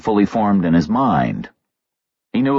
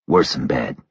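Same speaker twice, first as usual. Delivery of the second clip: low gruff voice; 7 semitones lower than usual. A narrator's reading. Reacher answering low in dark.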